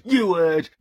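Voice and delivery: high, loud insistent retort; defiant repeat. Acted. Vlogger defends renaming The Alchemist, high defiant retort. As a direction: push it up defiantly.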